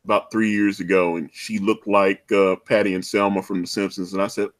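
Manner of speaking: ordinary talk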